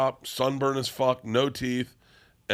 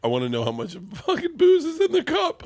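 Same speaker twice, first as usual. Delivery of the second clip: high, loud, strained outburst; loud excited demand; 8 dB louder than usual. Real, not acted. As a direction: belt it out, half-joking demand. Excited demand for booze amount.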